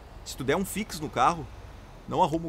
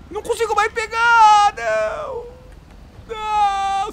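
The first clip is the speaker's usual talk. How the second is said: very high, loud, drawn-out wail; wailing shout; 9 dB louder, much slower than usual. Real, not acted. Gamer unable to get his car back, loud high wail. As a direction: howl it slowly in despair.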